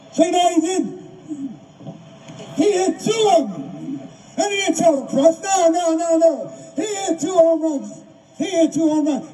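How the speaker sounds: high-pitched, emphatic excitement; thrilled, insistent retelling; excited storytelling. Real, not acted. Excited retelling of the home runs.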